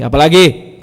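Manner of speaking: loud, curt retort; brusque intonation. Retold or imitated. Prophet asked again, voiced in preacher's own brusque way. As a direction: throw the question back roughly, with force. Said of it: "itu saya yang bikin intonasinya".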